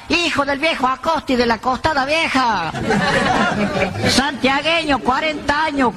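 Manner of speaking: high, slow shouted challenge to the lights; a hammed-up country man hollering, high and drawn out; calling out. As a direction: yell your name into the night, pitched high. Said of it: "y pega el grito".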